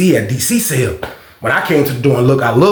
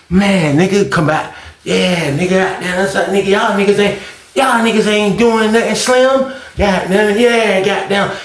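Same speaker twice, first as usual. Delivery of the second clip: imitated gambler's boasting, pitched up; dice-game trash talk; 6 semitones higher than usual. Gambler heckles the others at a dice game, imitated trash talk. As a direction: talk big at the dice table, voice raised in pitch. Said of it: "He be at the dice game".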